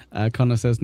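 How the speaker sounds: normal talking voice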